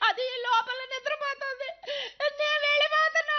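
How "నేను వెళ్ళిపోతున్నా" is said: tearful wail I'm leaving; weeping wife announces she is going; crying, raised. Acted. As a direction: sob it out, voice lifted. Wife crying, threatening to leave.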